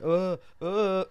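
high imitated groans, two short bursts. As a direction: mimic someone suddenly knocked out. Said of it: "She's like, she's like".

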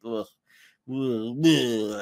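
repeated guttural "ugh"; revolted groaning; disgusted groan. Real, not acted. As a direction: groan as if sickened by your own words. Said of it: "that makes me sick to say that".

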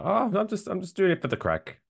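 high-pitched quote of Irish drug talk; imitating Irish people. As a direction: put on a high, lilting imitation. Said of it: "They do say that, though. They go".

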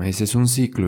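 ordinary talk

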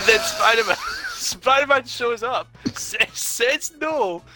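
high-pitched giggly telling, words squeezed through laughter; can barely get it out for laughing; real helpless laughter breaking up the story; laughing while telling. Real, not acted. Laughing while retelling funny scene.

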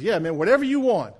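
a freed genie stretching, pleased; relieved genie. Acted.

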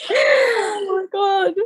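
half-laughing shriek of shock; real astonished wail; shocked cry. Real, not acted.

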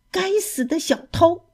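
high-pitched angry yelp at the mouse; angry cry at thief. Acted. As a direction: curse the little thief, voice shooting up. 老鼠抢走燕麦，小鸡大骂. (高声怒骂小偷)

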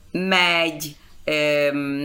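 ordinary talk, nothing marked

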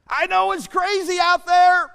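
high, loud preaching burst; loud, urgent reassurance; impassioned shout. Pretend. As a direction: cry it out to the crowd. God's words, preacher bursting out.

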